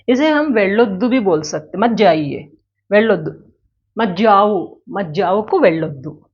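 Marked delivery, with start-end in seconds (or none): none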